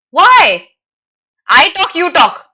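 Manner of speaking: ordinary talk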